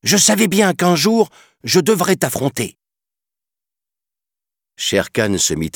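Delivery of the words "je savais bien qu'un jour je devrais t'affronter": put-on high, defiant child voice; brave boy. Mowgli defying the tiger, high brave child voice. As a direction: stand up to him bravely.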